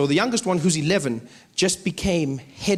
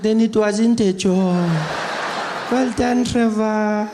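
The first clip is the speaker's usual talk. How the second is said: granny voice; 3 semitones higher, slower than usual. Retold or imitated. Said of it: "She's like".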